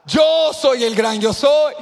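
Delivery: declara lento y fuerte; proclamación gritada. Acted. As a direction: truena como la voz de Dios. Dios declara su nombre. (proclamación lenta a gritos)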